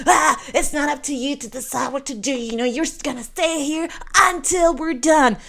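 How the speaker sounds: high-pitched mock official scolding; rude and mean. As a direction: imitate an officious checkpoint guard laying down the law. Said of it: "they're rude and mean".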